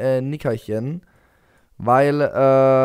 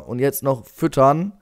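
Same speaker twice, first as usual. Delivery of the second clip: ordinary delivery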